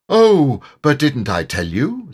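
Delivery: high, loud "oh" of sudden recollection; Rabbit. A narrator's reading. Rabbit remembers he has news for Eeyore, high lively Rabbit. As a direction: pitch it up as a storybook character remembering news.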